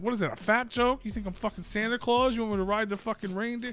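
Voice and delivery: high-pitched mock outrage; offended rant, acted out in a high voice; indignant prank caller. Tripping caller takes offence at his friend, indignant.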